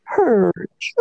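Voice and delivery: "Hurch." grunted, falling, beaten; defeated Hurch. Shown as a demonstration. Friend demos the classmate's defeated "Hurch." grunt. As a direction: let "Hurch." sag like a loser.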